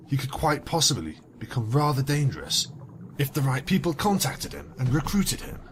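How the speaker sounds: ordinary delivery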